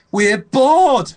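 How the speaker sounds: very high, loud whiny shout. Retold or imitated. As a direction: whine it loudly, way up high. Friend voiced whining in story.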